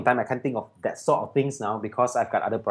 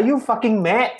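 higher-pitched incredulous retort; mock indignation; 8 semitones higher than usual. Student correcting friend who thinks he is Japanese, mock indignant. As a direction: act out an exasperated correction.

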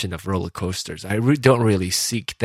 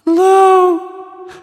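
squeaky hello; 9 dB louder than usual. Acted. Playful call after the joke.

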